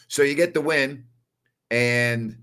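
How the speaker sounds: ordinary delivery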